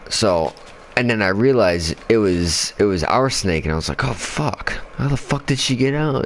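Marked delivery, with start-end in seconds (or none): 4.0-6.2 high-pitched disbelieving exclamation; retold dismay. Realizing it was their own snake, retold dismay. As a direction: re-enact the shock in a higher voice.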